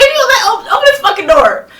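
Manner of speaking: high, loud shouted order; retold yell. Ordering roommate to open the locked door, loud and high. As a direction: demand they open up now.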